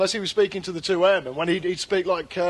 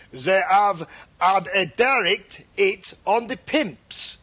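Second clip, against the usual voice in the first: put-on slow, hammy French-accented English; cod French accent; slower than usual. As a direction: announce it in a mangled French accent. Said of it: "he pronounced words wrong".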